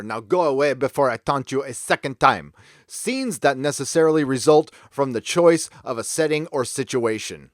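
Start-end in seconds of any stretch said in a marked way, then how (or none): 0.0-2.5 taunting in a hammed-up French accent; mock French accent. Sending someone away with a taunt, mock French accent. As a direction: jeer like a comic Frenchman, fake French accent. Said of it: "let me use my best French accent".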